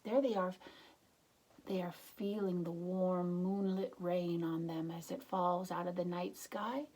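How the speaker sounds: plain reading voice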